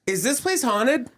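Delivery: lightly acted, high-pitched question; retold wary question. Guest asking the Airbnb lady about a ghost, high and wary. Retelling anxious question to host.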